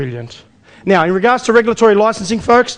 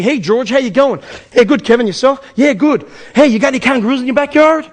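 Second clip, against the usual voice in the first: upbeat, higher retold phone chat; chatty voices acted out on the phone, higher; lively phone banter; 5 semitones higher, 4 dB louder than usual. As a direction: replay the call like a light skit.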